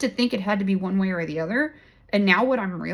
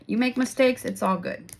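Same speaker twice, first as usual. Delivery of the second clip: usual speaking voice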